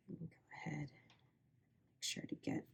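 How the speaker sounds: slow whispered aside; whispering. Real, not acted. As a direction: breathe it out softly to yourself. Quiet aside fetching loop.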